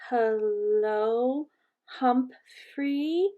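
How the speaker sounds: drawn-out words, each rising questioningly; hesitant learner. A narrator's reading. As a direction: sound it out slowly, unsure of each word. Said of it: "Missus Nasari said".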